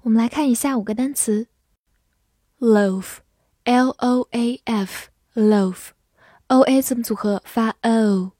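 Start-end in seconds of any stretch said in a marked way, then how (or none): 2.6-8.3 slow letter-by-letter spelling of loaf; spelling drill. Shown as a demonstration. (慢速逐字母拼读)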